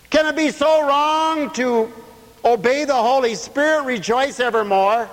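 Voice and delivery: high, drawn-out preacher's cadence; full-voiced preaching. Real, not acted. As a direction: preach it out, stretching the phrases high. Preaches at raised pitch.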